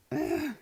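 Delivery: high, loud "Ah!" of frustration; short acted yell; exasperated cry. Girl fed up with the boy's answer, high frustrated cry.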